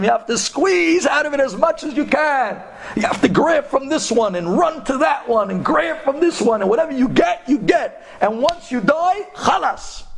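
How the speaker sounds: high, urgent tirade; heated ranting. Retold or imitated. Taxi driver urges grabbing all life offers, heated. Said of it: "He starts screaming".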